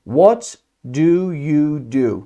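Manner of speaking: slow, word by word, pausing after "What"; slow model question. Shown as a demonstration. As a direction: spell out the question slowly for learners.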